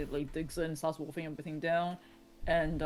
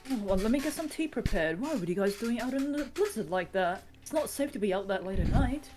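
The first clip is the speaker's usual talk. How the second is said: fussing hostess; 6 semitones higher than usual. Acted. GM voices Anna.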